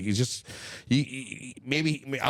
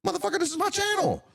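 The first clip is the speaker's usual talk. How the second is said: defiant. Acted. Defiant outburst at the troll.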